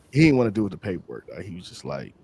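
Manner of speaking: ordinary delivery, nothing marked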